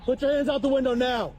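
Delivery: loud barked order; shouted command. Real, not acted. Officer ordering driver at gunpoint.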